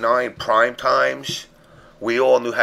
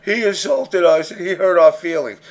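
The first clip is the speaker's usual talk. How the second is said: high, loud mock-hurt whining; mock crying; 6 semitones higher, 5 dB louder than usual. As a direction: complain like a wounded crybaby. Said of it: "not gonna go crying tomorrow".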